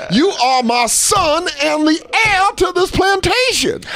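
very high, slow proclamation; drawn-out plantation master voice; outraged patriarch father. Acted. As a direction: declare it with hammed-up grandeur. Acted father lecturing son.

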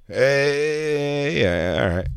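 laid-back impression with a stretched greeting; DJ Lou. Comic showing off his DJ impression, DJ Lou. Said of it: "D J Lou impression".

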